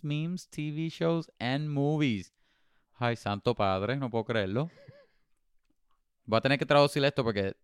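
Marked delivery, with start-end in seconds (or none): none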